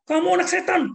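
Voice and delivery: very high, loud name-calling; yelling mother; angry scolding parent. Retold or imitated. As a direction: shout the insult at the child. Parent scolding child, acted quarrel.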